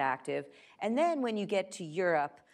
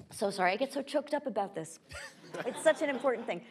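joking as if overcome with emotion; higher-pitched, kidding apology after a cough; mock choked up; 8 semitones higher, faster than usual. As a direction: play at being moved, laughing it off. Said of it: "No, so, I'm just kidding".

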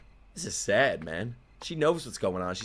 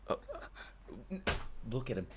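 ordinary talk

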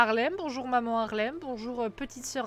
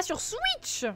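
high-pitched "sur Switch!"; surprised high "sur Switch!"; 12 semitones higher than usual. Real, not acted. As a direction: catch the odd word, amused. Misreads Twitch as Switch.

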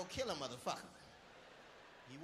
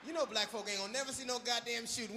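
ordinary talk

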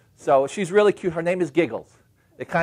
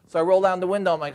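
normal talking voice

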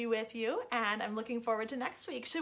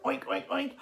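quick run of imitated oinks; playful pig noises. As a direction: oink like a storybook pig. Imitates the pig she produces.

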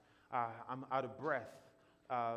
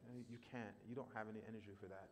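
softer, listless don't bother; depressed inner voice; 4 semitones lower, 11 dB quieter, faster than usual. Retold or imitated. Script in a depressed head telling him to stay in bed, listless. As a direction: let the words sag with no energy.

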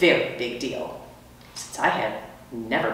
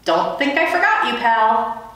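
friendly custodian; 8 semitones higher, 3 dB louder than usual. Acted. Custodian talking to hamster.

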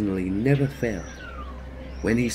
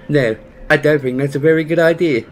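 impatient; 2 semitones higher, 7 dB louder, faster than usual. Acted. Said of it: "said Bagheera impatiently".

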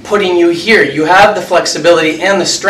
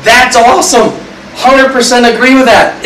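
mock enthusiastic agreement; 6 semitones higher than usual. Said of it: "and I'm like".

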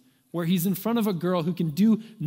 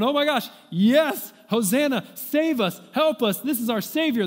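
crowd crying out; 7 semitones higher, 6 dB louder than usual. Retold or imitated. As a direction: hail the savior like an ecstatic crowd. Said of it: "They're crying out in worship".

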